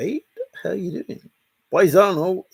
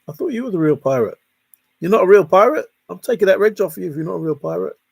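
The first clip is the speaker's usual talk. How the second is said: mock indignant; 6 semitones higher, 8 dB louder, much faster than usual. Playful mock threat.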